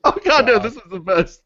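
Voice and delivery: breaking into high laughter; can't stop laughing; laughing hard. Real, not acted. Giggle fit over titles.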